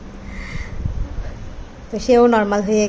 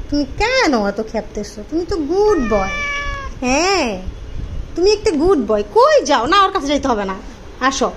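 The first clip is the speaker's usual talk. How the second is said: higher, coaxing sing-song to a cat; pet talk; 6 semitones higher than usual. Real, not acted. Comforting cat again. (বিড়ালকে আদুরে গলায় শান্ত করা)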